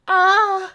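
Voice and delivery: high sharp yelp of pain; mock pain yelp. Man yelping in pain as he hurts his leg. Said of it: "hurts his leg".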